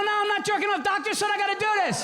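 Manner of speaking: high-pitched frantic shouting; frantic yell. Retold or imitated. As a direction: yell it high like you're caught out. Straining at urinal, yelling.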